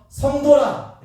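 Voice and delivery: high and loud; climactic proclamation. Acted. God calling his people saints, soaring loud. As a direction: ring it out as the peak of the call.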